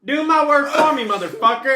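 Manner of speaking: put-on loud, high Southern-accented yell. Reenacting the quoted line on set, loud Southern accent. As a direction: belt out a mock Southern holler. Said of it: "very thick Southern accent in that specific line".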